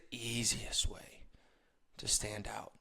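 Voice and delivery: slow whispered emphasis. Real, not acted. Host stressing handwritten notes, whispering. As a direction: hush it to make it land. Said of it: "emphatic whispered point".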